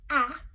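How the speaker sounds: "ah" as a loud, clear short a; short a sound. Shown as a demonstration. Teacher loudly models "ah" for listening game. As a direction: punch out "ah" so kids hear the vowel. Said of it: "If you hear".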